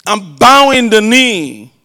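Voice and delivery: loud, forceful scripture reading; preaching scripture. Acted. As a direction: proclaim the passage to the whole church. Reads scripture at full force.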